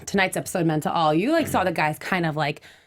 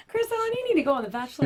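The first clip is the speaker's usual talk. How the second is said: higher, quick mock-mom urging; hurried high-pitched nudge, imitating a mother; imitating his mom; 7 semitones higher, much faster than usual. Co-host mimics guest's mom pushing the show, mock urging. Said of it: "She was like".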